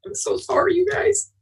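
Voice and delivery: breaking up while apologising to the audience; real tearful apology. Real, not acted. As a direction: voice high and choked with tears. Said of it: "I didn't know I was gonna feel so emotional".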